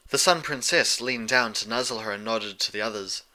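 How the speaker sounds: ordinary delivery, nothing marked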